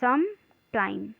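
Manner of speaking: "sometime" said slowly, with a gap between "some" and "time"; split in two. Shown as a demonstration. Teacher showing students how it is written, "sometime" slowly split in two. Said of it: "अलग-अलग ना लिख के".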